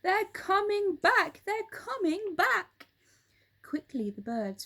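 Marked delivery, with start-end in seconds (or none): 0.0-2.9 high, loud screeching warning; screaming bird. A narrator's reading. Roly-Poly Bird screaming at the Twits, high frantic shriek.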